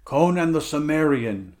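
loud, bold challenge; lion's challenge. Acted. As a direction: call out your name like a challenge. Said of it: "like the deep challenge of a lion".

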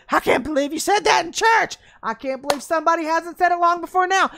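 hammed-up churchgoer gasping; mock amazed. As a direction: churchgoer stunned by the sermon, mock shout. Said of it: "everybody's like".

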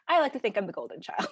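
quick, giggling self-mocking aside; laughing. Real, not acted. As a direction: laugh through the line at your own joke.

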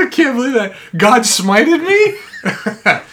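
high, loud, drawn-out comic incredulity; mock disbelief. Blinded man learning of the hoax, mock disbelief. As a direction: play-act a victim stunned by the news.

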